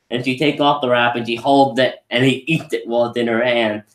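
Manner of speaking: ordinary talk, nothing marked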